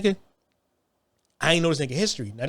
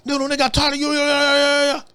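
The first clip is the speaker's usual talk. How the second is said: much higher and louder, mocking; higher mocking cellmate; 10 semitones higher, 5 dB louder than usual. Imitating the cellmate's bluster, much higher and louder. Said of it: "you know".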